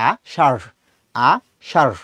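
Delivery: আ সার split as "aa-shaaṛh", h on the r; aspirated r demo. Shown as a demonstration. Teacher showing dhoy bindu ro, আ সার ending in r mixed with h. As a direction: pause after আ, end সার in a breathy flap. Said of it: "আষাঢ়টা কিরকম?".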